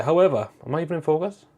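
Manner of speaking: ordinary talk, nothing marked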